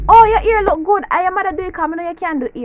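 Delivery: over-the-top admiring outburst; backhanded compliment. Acted. Jamaican complimenting someone's looks, loud mock admiration. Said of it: "This is how Jamaican compliment you".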